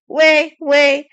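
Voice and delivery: loud, high call to clear the road; herald's shout. A narrator's reading. Herald clearing the street for knights, loud shout. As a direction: bellow at the crowd to make way. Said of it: "A herald shouted".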